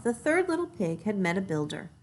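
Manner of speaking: ordinary delivery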